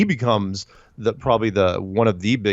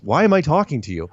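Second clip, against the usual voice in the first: higher-pitched, mildly exasperated retelling; irritated producer; 7 semitones higher than usual. Retold or imitated. Producer questioning a guest with nothing to pitch, mock irritated. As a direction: imitate an impatient producer losing interest. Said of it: "she's starting to get irritated".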